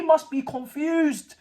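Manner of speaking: high, slow mocking sympathy; mock pity. Real, not acted. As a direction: rub it in gently. Teasing the owner.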